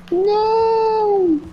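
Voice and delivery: high desperate cry; panicked scream. Real, not acted. Killer about to kill him.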